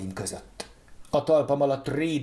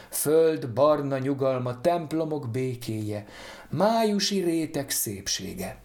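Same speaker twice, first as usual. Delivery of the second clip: plain reading voice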